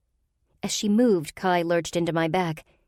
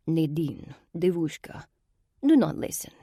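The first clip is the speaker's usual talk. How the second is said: put-on Russian-accented sorceress coaxing, rising at the end; heavy Russian accent. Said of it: "the heavily accented voice continued".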